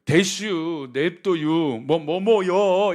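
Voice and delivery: drawn-out Chungcheong endings, 대슈 and 냅도유 slow and loud; 충청도 사투리로 느릿하게; Chungcheong dialect words. Shown as a demonstration. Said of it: "충청도 사투리에는".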